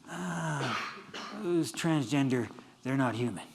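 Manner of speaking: mock dismissive. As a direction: imitate someone muttering a dismissal. Voices someone dismissing another person.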